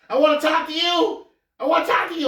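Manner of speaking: high falsetto plea, mock girlfriend voice; high mock girlfriend voice, pleading in the parking lot. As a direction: squeak it out like a begging girlfriend.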